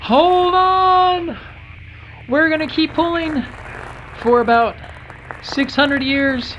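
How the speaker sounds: high, loud, urgent calling; excited rescue call. Pretend. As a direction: call out to save him.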